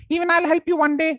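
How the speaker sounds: very high, squeaky grateful mouse; tiny squeaky mouse thanking; shrill mouse voice. Acted. Mouse thanks the lion for saving it, squeaky. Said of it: "It was shrill".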